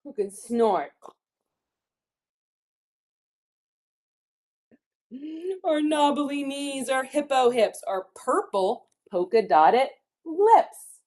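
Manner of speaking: picture-book rhyme reading, key words punched; children's story reading. A narrator's reading. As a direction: read the rhymes with big playful swoops in pitch. Reads rhyming book to children.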